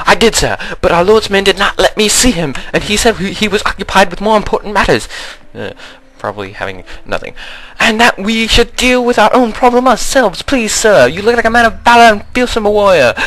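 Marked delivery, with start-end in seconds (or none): 0.1-5.1 high, fast frightened peasant voice; high pleading villager. Acted. Villager dialogue continues. 7.8-13.1 high, fast frightened peasant voice; high pleading villager. Acted.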